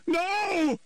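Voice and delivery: high, loud scream; mother shouting to stop her son. A narrator's reading. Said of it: "Nancy shouted".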